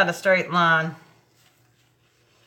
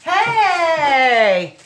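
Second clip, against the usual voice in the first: a bright, loud, higher hey to a friend at the door; loud greeting; 7 semitones higher, 6 dB louder than usual. Real, not acted. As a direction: call out hey as company walks in. Greets arriving friend loudly.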